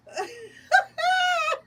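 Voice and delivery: very high, loud burst of laughter; shrieking laugh. Real, not acted. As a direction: laugh helplessly at it. Laughing at memory.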